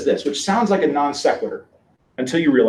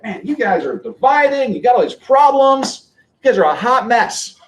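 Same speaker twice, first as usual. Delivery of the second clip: higher, louder and slower, mock exasperated Paul; mock scolding; 8 semitones higher, 4 dB louder than usual. Paul scolding the church in Corinth, mock exasperated. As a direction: scold the church like a fed-up apostle. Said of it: "Paul is just beating the tar out of".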